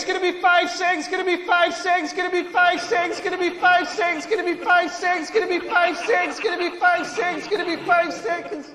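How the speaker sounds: rising countdown. Pretend. Countdown like a download bar.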